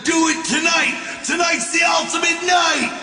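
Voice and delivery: high, insistent pep talk, building to a shout; arguing with himself. Acted. Talking himself into a night run, urgent and high. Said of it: "I was arguing with myself again".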